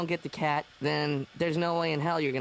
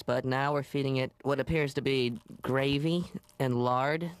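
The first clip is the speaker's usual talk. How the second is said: ordinary delivery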